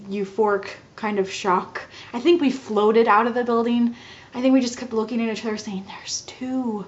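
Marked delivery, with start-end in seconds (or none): 5.9-6.8 hushed, breath-only murmur of wonder; soft stunned whisper; awed whisper. Retold or imitated. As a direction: whisper it in dazed disbelief. Retelling dazed exchange between the couple.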